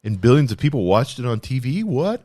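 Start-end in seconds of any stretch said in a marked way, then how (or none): 1.8-2.2 incredulous what. Pretend. Mocking sports fans' habits.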